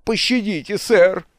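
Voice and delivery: high, whining plea; tearful pleading. A narrator's reading. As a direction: beg for mercy through tears. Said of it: "Зарёванный капитан Крюк повалился на колени". (жалобно моля о пощаде)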